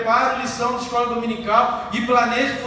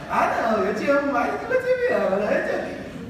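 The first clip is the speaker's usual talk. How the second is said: lovey-dovey sweet talk; giggly late night call; 4 semitones higher than usual. Retold or imitated. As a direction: coo it like a smitten teen. Lovers' phone call at night.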